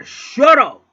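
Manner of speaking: very loud, high command; angry yell. Retold or imitated. Man with broom facing caged dog, angry yell. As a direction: explode on it, end the argument. Yelling after hitting the cage.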